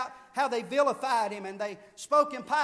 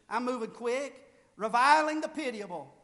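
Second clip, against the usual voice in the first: usual speaking voice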